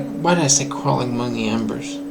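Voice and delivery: ordinary talk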